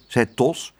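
usual speaking voice

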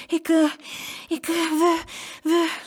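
high, halting panted words; stammering, out of breath. A narrator's reading. Children breathless from running answer farmer, halting stammer. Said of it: "ze kwamen niet uit hun woorden".

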